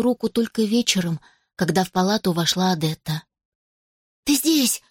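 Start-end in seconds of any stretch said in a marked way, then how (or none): 4.2-4.8 high surprised cry. A narrator's reading. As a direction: burst out in surprise. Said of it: "удивилась Джейд".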